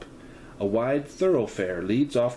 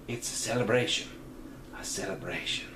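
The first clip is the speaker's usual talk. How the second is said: trailing off; 7 dB quieter than usual. Acted. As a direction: drift away mid-thought. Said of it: "She tails off for a moment".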